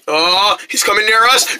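usual speaking voice

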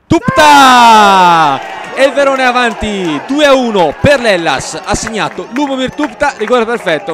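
loud, high goal shout, drawn out; breathless italian goal commentary. Real, not acted. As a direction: roar the penalty goal in Italian. Commentator calls the penalty goal.

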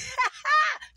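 short, loud, high burst of laughter; real high cackle. Real, not acted. Laughs at those accusing magic.

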